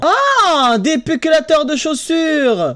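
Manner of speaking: high, drawn-out exclamation of wonder; mock amazement. Streamer marvelling at a shoe gadget, mock amazement. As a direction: exclaim it high, hammed-up awe.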